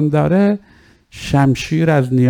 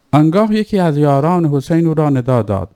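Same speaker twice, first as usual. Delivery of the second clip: ordinary talk, nothing marked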